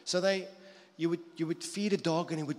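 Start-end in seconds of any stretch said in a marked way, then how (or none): none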